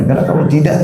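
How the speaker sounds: ordinary talk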